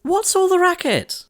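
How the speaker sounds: very high, loud shriek; crying out. A narrator's reading. Cindy shrieking from the kitchen at the noise. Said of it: "Cindy cried".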